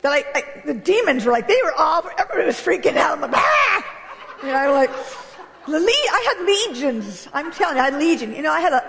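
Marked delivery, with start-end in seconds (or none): 3.3-3.8 a high, laughing shriek; mock scream. Retelling her demons freaking out, a laughing shriek. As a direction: squeal in playful panic.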